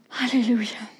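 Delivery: soft unvoiced hallelujah; whispered praise. Real, not acted. Pastor closes the prayer call in a whisper. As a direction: breathe the word out as a whisper.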